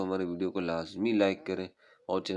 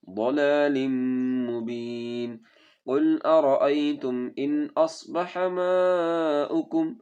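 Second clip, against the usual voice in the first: melodic chanted tajweed recitation; Quran recitation; 6 semitones higher, 7 dB louder, much slower than usual. Shown as a demonstration. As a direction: recite slowly and musically, stretching vowels.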